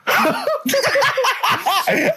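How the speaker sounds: high burst of laughter; helpless high laugh. Real, not acted. Friend laughs at the fable's punchline, high laugh.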